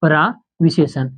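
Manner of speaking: प्रविशेषण said slowly, each syllable clear; slow classroom repetition of प्रविशेषण; slow repeated term. Shown as a demonstration. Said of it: "आप आगे भी पढ़ेंगे इसको".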